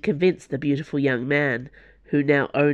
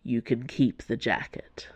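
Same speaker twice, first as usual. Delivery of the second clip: low, soft drawl; drawled reply, dropped low and quiet; 4 semitones lower, 6 dB quieter than usual. A narrator's reading. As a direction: let it drawl out softly, low in pitch. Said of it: "he drawled".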